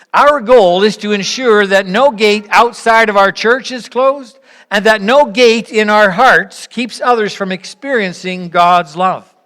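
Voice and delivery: normal talking voice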